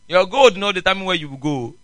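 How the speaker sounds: usual speaking voice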